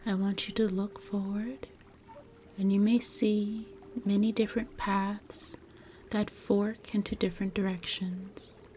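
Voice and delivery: measured, soothing guide with long pauses; guided meditation. Acted. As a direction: gently lead the listener into relaxation, phrase by phrase.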